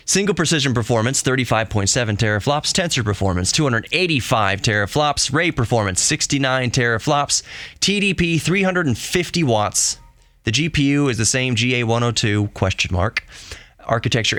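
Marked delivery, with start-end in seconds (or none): none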